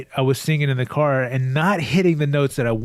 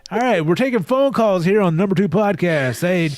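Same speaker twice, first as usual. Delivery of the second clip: radio host; 6 semitones higher than usual. Pretend. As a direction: take calls like a hyped radio host. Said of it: "talk host voice".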